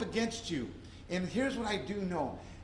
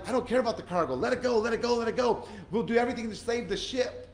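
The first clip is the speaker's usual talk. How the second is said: higher, louder urgent calls; urgent sailors; 6 semitones higher, 4 dB louder, faster than usual. Retold or imitated. Preacher voicing sailors lightening the ship, urgent call. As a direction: throw the cargo, shout it.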